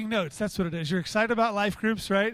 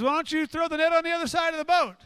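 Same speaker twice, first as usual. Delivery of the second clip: high, raised call across the water; 7 semitones higher, 4 dB louder, faster than usual. Acted. Man on shore advises fishermen, calling out. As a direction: shout helpful advice to a distant boat. Stranger calls advice to boat.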